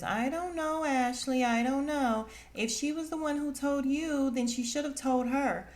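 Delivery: high put-on voice, lightly hammed; mocking Mia. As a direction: mimic her hedging. Mia mocked again.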